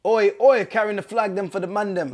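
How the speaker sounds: playful Jamaican patois shout. Pretend. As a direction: hype it up in patois.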